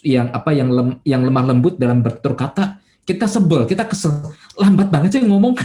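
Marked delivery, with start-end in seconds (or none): 4.5-5.6 mock impatient jab, higher and a bit pushed; a hint of an irritated coworker; impatient complaint.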